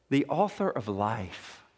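low, soft voice of awe; hushed, grave. Real, not acted. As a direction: drop right down, solemn and quiet. Preacher first delivers verse gravely.

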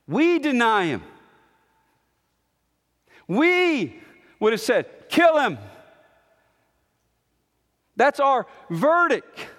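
high, emphatic confession of guilt; forceful preaching. Real, not acted. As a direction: hurl each short phrase at the congregation.

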